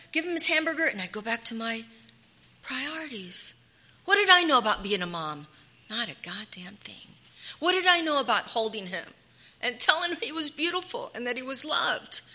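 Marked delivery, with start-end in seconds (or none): 5.9-7.1 whispered bitter admission. Real, not acted. Mother admitting she knew nothing, whispered. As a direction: say it under your breath.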